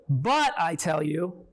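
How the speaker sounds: slow, heavy "But" opening the phrase; contrasting stress. Shown as a demonstration. Teacher giving the rejected translation, slow stress on "But". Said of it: "No longer is it".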